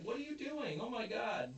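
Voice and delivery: high-pitched imitation of embarrassed disbelief; retold horrified son, voice shooting way up; mock appalled outcry. Retold son appalled at his mother.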